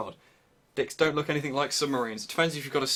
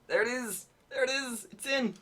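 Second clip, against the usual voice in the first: much higher, thrilled cries; excited relief; 12 semitones higher than usual. Real, not acted. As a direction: squeal with relief. Relief as it fits.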